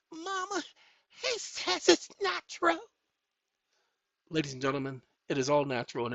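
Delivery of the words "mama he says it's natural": put-on high kid voice calling mama; high childish voice. A child telling Mama the vlogger says it's natural, in a high squeaky voice. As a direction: squeak it out like a little child tattling.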